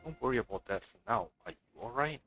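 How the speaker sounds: normal talking voice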